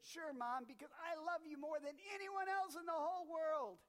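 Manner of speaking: put-on sweet kid promising his sick mom; high sing-song kid voice; child's voice. Johnny voiced in story.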